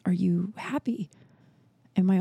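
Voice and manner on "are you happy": soft whisper. Retold or imitated. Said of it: "he goes".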